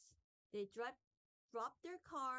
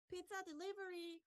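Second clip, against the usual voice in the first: high, quick delivery call; 8 semitones higher, faster than usual. A narrator's reading. Unfamiliar voice outside the boys' tent, high quick call. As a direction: put on a stranger's high call. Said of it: "called an unfamiliar voice".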